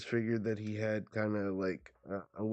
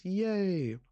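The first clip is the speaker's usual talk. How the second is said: high cheer; 9 semitones higher, 4 dB louder than usual. Acted. Child character cheers.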